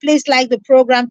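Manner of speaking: normal talking voice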